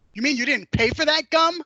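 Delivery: high, loud shocked mother's question; shocked disbelief. Retold or imitated. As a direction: gasp it out in disbelief. Mother realizes gum was stolen.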